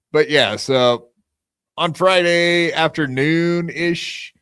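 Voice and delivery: usual speaking voice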